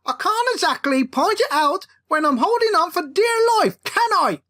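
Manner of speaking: exasperated shout while hanging on; angry retort. Acted. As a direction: bite back at your brother in fury. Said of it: "answered Jacob angrily".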